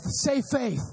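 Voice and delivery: normal talking voice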